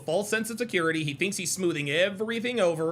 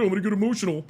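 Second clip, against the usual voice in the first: mocking Abba; much faster than usual. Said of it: "That's what Abba said".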